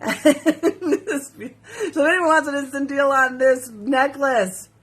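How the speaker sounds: giggling through the pitch; laughing. Real, not acted. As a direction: crack up as you offer it. Laughing while pitching necklace.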